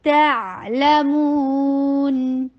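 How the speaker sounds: تعلمون recited slowly and melodically; tajweed demo of تعلمون; slow recitation. Shown as a demonstration. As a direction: draw out تعلمون. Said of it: "ٹو counts long".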